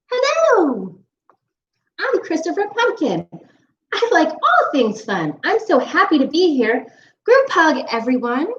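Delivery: high, bright-eyed friendly pumpkin; cheerful pumpkin voice. Acted. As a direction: greet everyone like a happy little pumpkin. Pumpkin character voice.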